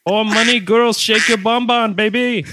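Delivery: put-on a cheesy Indian accent, sleazy club host; bad Indian accent. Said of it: "I gotta work on my Indian accent".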